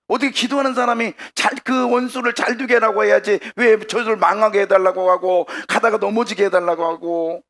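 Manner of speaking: high, loud, scandalized protest; hammed-up outraged questioning; acted indignant objection. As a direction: argue hotly in one long rush. Acts out sister-in-law's scandalized objection.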